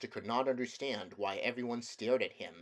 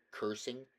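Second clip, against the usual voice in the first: ordinary delivery, nothing marked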